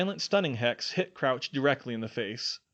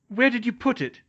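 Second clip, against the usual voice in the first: loud, high demand; 12 semitones higher, 6 dB louder than usual. Acted. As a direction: press him hard for the answer. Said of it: "she demanded".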